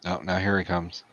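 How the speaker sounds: ordinary delivery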